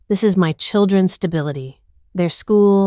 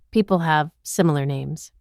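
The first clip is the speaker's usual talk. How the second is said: ordinary delivery, nothing marked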